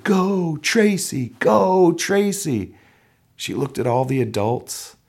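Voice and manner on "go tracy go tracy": crowd cheer. Retold or imitated. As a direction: chant her name from the stands. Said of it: "The crowd cheered".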